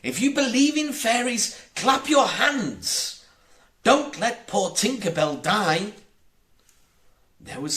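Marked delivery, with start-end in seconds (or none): 0.0-5.9 high, loud call to the dreamers. A narrator's reading. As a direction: shout it out to every child listening. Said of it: "He called out".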